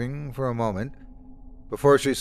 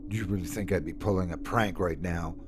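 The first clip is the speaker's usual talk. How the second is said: ordinary delivery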